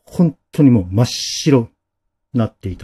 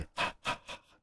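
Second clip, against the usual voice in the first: imitated breathless panting; quick unvoiced gasping breaths; 22 dB quieter, much faster than usual. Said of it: "息切らしてる".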